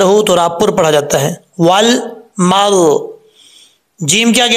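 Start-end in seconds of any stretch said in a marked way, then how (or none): 1.6-3.1 drawn-out वल मल; slow recitation. Shown as a demonstration. Teacher reciting the phrase, slow chant. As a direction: recite it slowly. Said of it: "recites the phrase".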